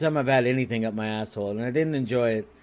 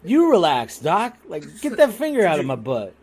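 mock retort; 4 semitones higher, 4 dB louder than usual. Shoots the doctor's words back.

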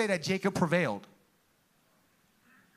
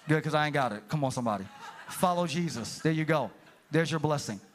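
laughing; 7 semitones lower than usual. Real, not acted. Joking with quiet crowd.

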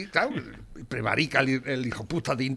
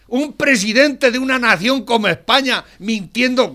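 indignant rant; 6 semitones higher, 6 dB louder than usual. Real, not acted. Rant about the lying president.

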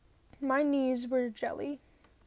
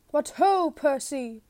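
cheerful shout; 2 semitones higher, 8 dB louder, slower than usual. A narrator's reading. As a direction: call out across the gallery. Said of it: "he shouted".

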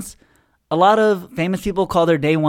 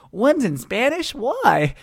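mock surprise; 8 semitones higher, much slower than usual. Siblings' reaction voiced in story.

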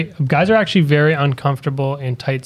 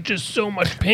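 weak pained voice; 5 semitones higher, 8 dB quieter, slower than usual. Retold or imitated. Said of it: "And she's like".

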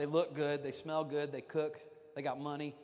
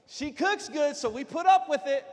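higher, louder playful exaggeration; mock confession; 12 semitones higher, 13 dB louder than usual. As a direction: ham it up, teasing the men. Mock admission, high and loud.

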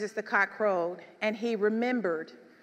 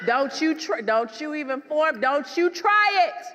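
fierce warning; 5 semitones higher, 5 dB louder than usual. Acted. Mother warning Satan off son.

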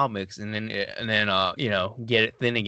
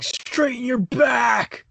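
high, drawn-out shout, much louder; yelling; 13 semitones higher, 6 dB louder, much slower than usual. Retold or imitated. Lifter correcting workout partner Castro's posture, yelled. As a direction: yell it like a drill-style coach correcting form. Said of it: "I'm usually the one yelling".

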